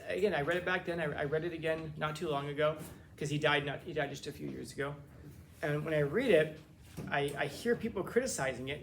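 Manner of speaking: usual speaking voice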